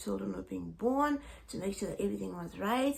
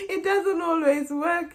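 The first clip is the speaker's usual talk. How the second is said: amused; 7 semitones higher, 8 dB louder, slower than usual. Real, not acted. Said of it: "trying to read my notes".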